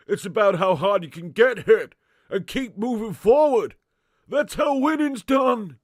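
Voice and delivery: loud, slurred movie-boxer shout; Sly. Acted. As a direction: bellow it like a battered boxing champ. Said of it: "Give Sly an impression of Sly".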